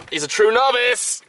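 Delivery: slow, high, loud jeer; mocking shout. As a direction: shout it high and drawn out, announcing the novice. Mocking learner who cannot get in.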